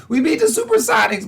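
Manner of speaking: mock crying. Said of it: "he's just crying".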